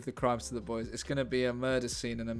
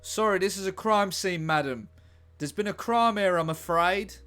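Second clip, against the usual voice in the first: higher, louder, smug constable talking down to her; patronizing policeman; 5 semitones higher, 7 dB louder than usual. Acted. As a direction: lord it over the lady detective, loud and pitched up. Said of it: "That's how he talks".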